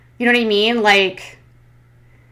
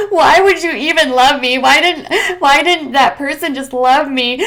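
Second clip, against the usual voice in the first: hammed-up tearful wail, high and loud, laughing through it; giggling mock sob; mock crying; 6 semitones higher, 5 dB louder than usual. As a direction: overplay a sobbing plea. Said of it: "sitting on her couch crying".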